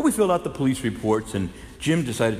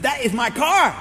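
loud, high shout of recognition; astonished shout at finding the stolen car; surprised shout; 13 semitones higher, 7 dB louder than usual. Retold or imitated. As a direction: yell it out in amazement.

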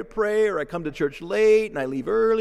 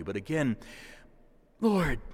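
normal talking voice

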